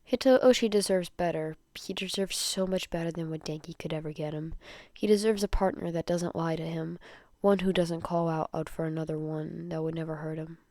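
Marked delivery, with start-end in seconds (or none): none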